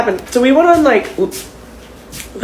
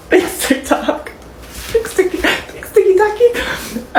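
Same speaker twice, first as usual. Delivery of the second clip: teary laugh; 9 semitones higher, much slower than usual. Real, not acted. Laughing through tears at TikTok.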